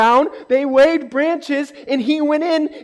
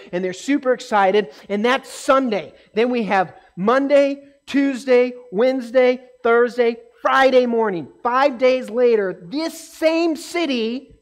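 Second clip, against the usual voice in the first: normal talking voice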